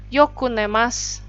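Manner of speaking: ordinary delivery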